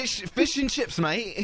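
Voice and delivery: chirpy English fish-and-chips voice; English accent. Pretend. Friends joking, putting on an English accent. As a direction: put on a Cockney twang. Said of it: "See, I can do, I can do this one pretty well".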